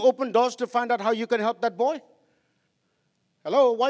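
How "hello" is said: ordinary delivery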